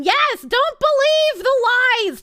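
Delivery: slow, loud, high declaration; emphatic. Real, not acted. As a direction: proclaim it to the whole chat. Defending Boston origin to chat.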